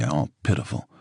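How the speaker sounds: plain reading voice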